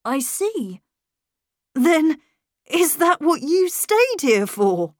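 laughing, a little angry. A narrator's reading. Miles challenging the governess, amused with a little anger. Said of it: "there was a little anger too".

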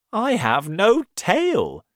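the fox moans about his lost tail; Mister Fox, glum. A narrator's reading. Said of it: "he said".

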